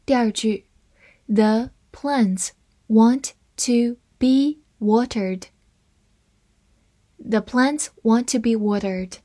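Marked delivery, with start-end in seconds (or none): 1.3-5.5 slow, careful read of each word. Shown as a demonstration. Teacher reading the second sentence slowly for learners. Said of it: "我们分别来慢读一遍".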